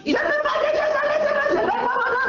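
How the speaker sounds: high, frantic shouts; panicked shouting. Acted. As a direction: scream to be let go. Woman panics, fights off staff.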